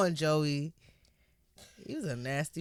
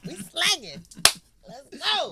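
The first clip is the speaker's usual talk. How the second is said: hyped; 10 semitones higher, 4 dB louder, slower than usual. Real, not acted. Reactor joining the story, hyped.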